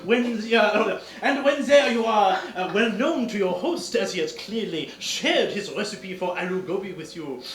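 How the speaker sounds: lightly hammed French detective, pleased with himself; French accent. Detective showing off to the countess, French accent. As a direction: lay out the deduction with Gallic flair.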